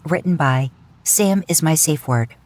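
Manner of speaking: usual speaking voice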